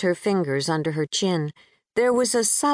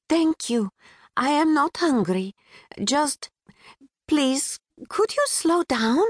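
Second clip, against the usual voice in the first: put-on a touch of Pakistani accent; 8 semitones higher than usual. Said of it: "a bit sing-song, infused with a British twang".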